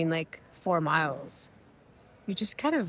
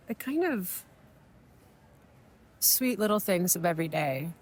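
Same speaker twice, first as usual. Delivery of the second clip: ordinary talk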